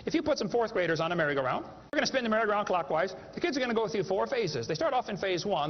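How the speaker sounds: usual speaking voice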